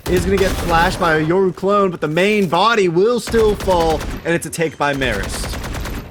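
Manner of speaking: loud, rising play-by-play call; excited shout. Real, not acted. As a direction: call the take at full hype. Caster calls the decisive take.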